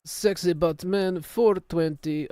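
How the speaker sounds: Werner Herzog impression. Streamer announces the gamer tag as Werner Herzog, slow and grave. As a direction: pronounce it slowly and solemnly, like a documentary. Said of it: "Werner Herzog impression".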